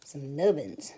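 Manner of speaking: a hint of a silly cartoon voice; silly nubbins voice. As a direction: play with the word nubbins in a mock-funny voice. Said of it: "I don't know why I have to say it like that".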